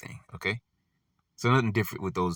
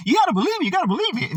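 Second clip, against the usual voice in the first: high, shrill-pitched desperate plea; hammed-up desperate woman insisting; frantic pleading woman; 5 dB louder, much faster than usual. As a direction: beg like a frantic woman nobody believes. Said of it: "grab on her clothes and, you know, shaking".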